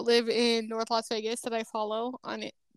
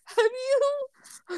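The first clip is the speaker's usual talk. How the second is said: laughing, higher and slow; 8 semitones higher, much slower than usual. Real, not acted. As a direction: giggle it out at her. Laughing at friend's joke.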